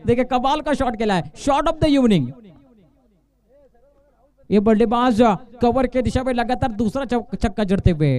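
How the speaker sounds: ordinary delivery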